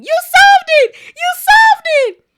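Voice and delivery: high, loud excited scream; screaming Hermione. Acted. Hermione running to Harry, screaming with joy. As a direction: shriek it running to him. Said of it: "running towards him screaming".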